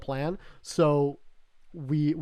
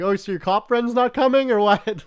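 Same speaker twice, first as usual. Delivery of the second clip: higher, louder mock-innocent question; retold taunt; 6 semitones higher, 7 dB louder than usual. Teller goading the driver as he leaves, mocking call. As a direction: needle him loudly, acting it out.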